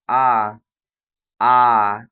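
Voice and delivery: आ held long and loud; long vowel demo. Shown as a demonstration. Teacher showing the long vowel, loud and drawn. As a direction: drag out आ. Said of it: "इसमें समय लग रहा है".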